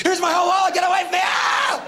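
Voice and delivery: terrified passerby. Acted. Scared passerby giving up wallet, screaming. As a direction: shriek and shove the wallet over.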